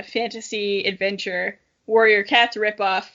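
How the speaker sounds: ordinary talk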